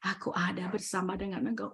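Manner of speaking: very soft, low reassurance; gentle voice of God. Retold or imitated. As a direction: speak tenderly, low and hushed, as God comforting. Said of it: "Tuhan bicara lembut buat saya".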